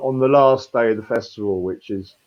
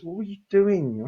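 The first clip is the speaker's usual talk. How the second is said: lifeboat crew scolding; 9 semitones higher, 4 dB quieter than usual. Retold or imitated. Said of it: "The lifeboat comes and goes".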